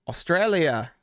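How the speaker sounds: put-on lightly done Australian accent, high and falling; a hint of an Australian saying Australia; playful try at an Australian accent. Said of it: "I don't have the best Australian accent".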